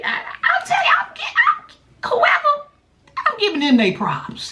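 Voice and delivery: near-shrieking excitement; excited. Real, not acted. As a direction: shout out how good they are. Excited praise of hackers.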